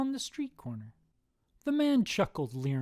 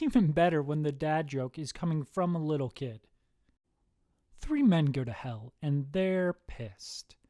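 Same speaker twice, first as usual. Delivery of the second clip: ordinary delivery, nothing marked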